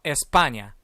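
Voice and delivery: "에스파냐" loud and syllable-clear, stretched "파"; model pronunciation. Shown as a demonstration. Teacher asking class to repeat after her, model pronunciation. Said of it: "한 번 더".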